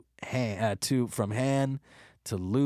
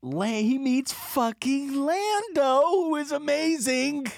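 high excited rant; 9 semitones higher than usual. Real, not acted.

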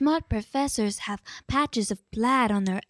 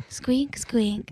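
squeaky shoe noises; rubbery high squeaky toy. Acted. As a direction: imitate squeaking shoes. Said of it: "doing some more Foley work".

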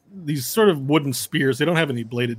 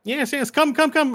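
eager halfling reporter; 13 semitones higher than usual. Acted. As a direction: squeeze through and pitch politely. Reporter beckons Varel.

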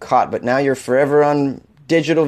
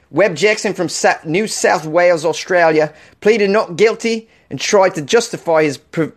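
higher-pitched, hammed-up Aussie accent; Australian accent; 5 semitones higher than usual. Host reading an odd news story aloud, put-on Australian accent. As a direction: read it in a bad mock Australian accent. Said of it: "Let me do the rest of the story with an Australian accent".

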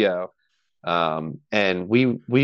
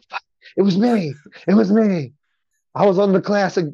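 high, emphatic retold protest; excited insistence; 9 semitones higher, 4 dB louder than usual. Guest retelling convincing his doubting friend it was him, high excited insistence. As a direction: insist on it, high-pitched and punchy.

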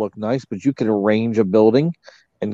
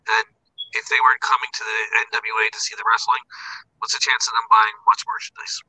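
tinny bad phone line; tin can phone; 3 semitones higher, faster than usual. Real, not acted. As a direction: voice like talking into a can. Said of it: "Your, your phone just went weird".

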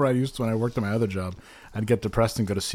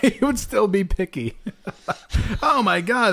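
high laughing, then an exclaim; laugh, then burst out; 9 semitones higher than usual. Real, not acted. Laughing, then an excited outburst.

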